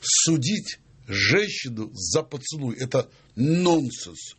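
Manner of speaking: word by word, very slowly; slow emphatic pronouncement. Shown as a demonstration. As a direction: spell out the absurdity at a deliberate pace. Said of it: "как звучит?". (медленно, с расстановкой, как вердикт)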